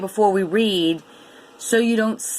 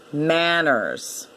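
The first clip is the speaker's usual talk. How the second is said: normal talking voice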